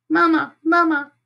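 put-on very high, tiny baby-bird call; calling gosling. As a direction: pipe up like a little gosling calling its mother. Gosling calls for Roz.

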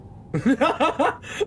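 high burst of laughing after a fright; nervous laugh. Real, not acted. Player laughs off a jump scare, high nervous laugh.

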